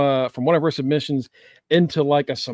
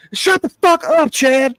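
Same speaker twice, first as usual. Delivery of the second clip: much higher, louder mock yell at Chad; 10 semitones higher, 5 dB louder, slower than usual. Co-host yells at Chad to shut up, mock yell. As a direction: shout him down, hammed up.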